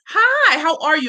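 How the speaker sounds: high, sing-out hello that eases down; excited greeting. Real, not acted. Host spots a doctor among her guests, excited. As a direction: greet with a high burst of delight.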